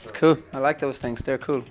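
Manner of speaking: ordinary delivery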